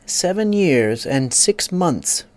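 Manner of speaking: slow, musing repetition; thoughtful repeat. A narrator's reading. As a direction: turn the number over in your head. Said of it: "repeated thoughtfully".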